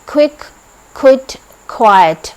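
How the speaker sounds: क्विक, क्विट, क्वाइट begun with a strong ख; aspirated kw. Shown as a demonstration. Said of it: "क्यू की sound ख".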